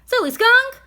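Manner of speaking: high, loud exclamation; exclaiming. A narrator's reading. Old woman scolding the stubborn skunk, high loud exclaim. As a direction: burst out at the silly skunk. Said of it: "exclaimed Euphonia".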